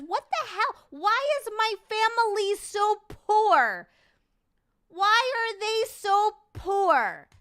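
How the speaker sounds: high, loud, slow outraged wail; mock outraged whine. Real, not acted. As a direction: howl it in disbelief. Shocked whine over inheritance.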